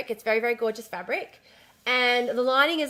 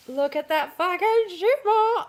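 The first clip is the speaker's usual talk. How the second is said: higher, slow, delighted exclaim; excited gush; 7 semitones higher than usual. Real, not acted. To her viewers, showing off the shimmery fabric, excited gush. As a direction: let the delight burst out, drawn out.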